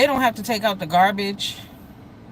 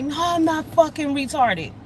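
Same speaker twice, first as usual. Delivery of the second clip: imitating an angry son, pitched higher; mock indignant retort in a raised pitch; mad son; 6 semitones higher than usual. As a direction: act out his angry comeback, higher. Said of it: "So he's mad".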